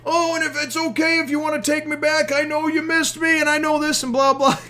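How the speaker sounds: high, whiny babbling excuses; whiny Usopp rant. Retold or imitated. Usopp babbling excuses at his crew, high whiny voice. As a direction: ramble high and pleading like a braggart. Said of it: "spewing out his bullshit to everybody".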